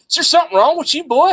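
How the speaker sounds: high-pitched mock Southern twang; Southern drawl. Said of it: "if you'd have heard the drawl".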